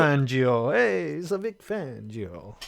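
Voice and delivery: a light mock Italian accent on the name. Podcaster joking about coach Vic Fangio, in a light Italian accent. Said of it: "because I said something in Italian accent".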